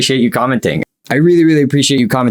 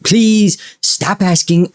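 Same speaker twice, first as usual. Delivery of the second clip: higher and slow, stretching please; drawn-out plea; 6 semitones higher, much slower than usual. Pretend. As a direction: whine "Please" long and high, then slow "stop asking".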